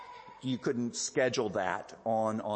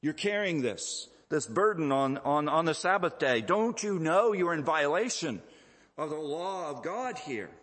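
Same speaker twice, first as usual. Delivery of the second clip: acted religious objector, pitched higher; mock indignant scolding in a raised voice; accusing challenge; 5 semitones higher than usual. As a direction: call out a rule-breaker, hammed-up. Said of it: "He goes, "Oi, what are you doing?".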